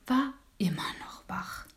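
whispered, dropping away; hushed refrain. A narrator's reading. Narrator tells the children the dormouse is still awake, whispered. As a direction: breathe it out like a secret at bedtime.

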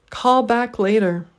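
Call, back, la stressed slowly, ter unstressed; slow Call back later with a weak ter; deliberate three-beat Call back later; three stresses, one weak. Shown as a demonstration. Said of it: "three stressed syllables and one unstressed syllable".

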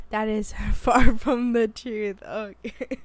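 loud, slow, laughing through it; emphatic and amused, drawn out loudly; laughing debunk. Real, not acted. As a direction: burst out laughing mid-sentence. Laughing as she debunks the hype.

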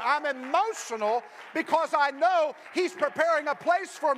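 loud, high preaching climax; rousing sermon shout; full-force preaching. Acted. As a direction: preach it hard to the congregation.

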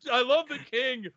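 normal talking voice

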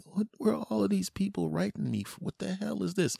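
high-pitched mock bewilderment; baffled stranger. Stranger getting misdirected email, high and baffled. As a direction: put on a squeaky confused voice.

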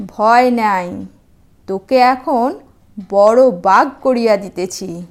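calm the scared deer like a gentle sage; kindly sage. Acted. Sage reassures the frightened deer.

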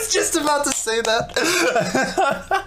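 high, slow laughing words. Real, not acted. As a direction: say it through real laughter. Laughing at shared joke.

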